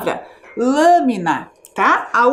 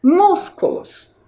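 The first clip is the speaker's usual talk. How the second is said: "Músculos" slow and higher, with a strong MÚS; stress on first syllable; 5 semitones higher, slower than usual. Shown as a demonstration. Teacher answers which syllable is strongest, MÚS in "Músculos" stressed. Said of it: "É a sílaba mus".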